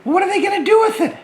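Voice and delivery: high, fast little puppet voice; curious puppet asking the host, high and quick; puppet George. Acted.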